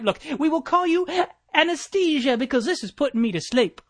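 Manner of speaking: ordinary delivery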